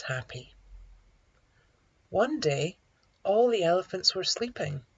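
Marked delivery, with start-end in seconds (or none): none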